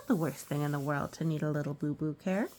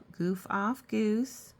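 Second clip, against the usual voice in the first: ordinary delivery, nothing marked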